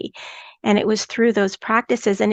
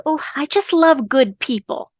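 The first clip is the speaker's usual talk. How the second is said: lightly voiced sweet old lady; a hint of a cute little grandma, higher; cute elderly voice; 5 semitones higher than usual. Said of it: "with her cute little elderly voice".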